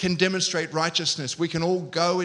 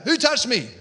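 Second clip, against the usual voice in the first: higher, mock yelled question; yelled demand; 4 semitones higher, 3 dB louder than usual. Shown as a demonstration. Jesus as wrongly imagined stopping the crowd, yelled demand. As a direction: call out the question accusingly. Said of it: "I don't reckon Jesus yelled out".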